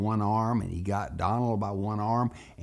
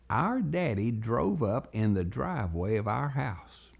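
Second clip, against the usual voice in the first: ordinary delivery, nothing marked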